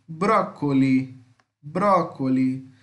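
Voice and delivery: brócoli said slowly with a hard C sound; brócoli lento, con la C dura; slow model word. Shown as a demonstration. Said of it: "Lembra, tem dois Cs".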